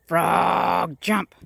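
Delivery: very loud, drawn-out frog, punched jump; acted yell. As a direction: yell the order as the frustrated mad scientist. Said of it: "He yelled again".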